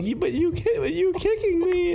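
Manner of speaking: very high, strained pleading creature voice; squeaky deer voice. Acted. Prank caller voicing the deer, squeaky high whine.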